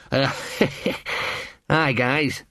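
small breathy laugh, then a weak hello; nervous laughing greeting. A narrator's reading. Little dog greeting two huge thugs, nervous chuckle. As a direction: laugh uneasily before greeting them.

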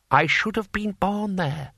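lightly voiced lord musing to himself; wistful ambitious lord. A narrator's reading. Lord Hong's thought, voiced.

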